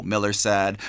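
plain reading voice

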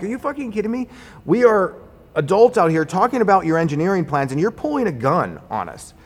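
high-pitched retold scolding, easing toward the end; retold incredulous outrage. Contractor scolding engineer for pulling a gun, incredulous. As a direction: start high in disbelief, then come down.

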